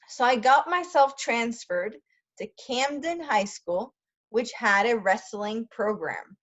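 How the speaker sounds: expressive read-aloud of a memoir; storyteller voice for a class; story reading. A narrator's reading. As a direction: read the story with feeling, for learners. Reads memoir aloud in English.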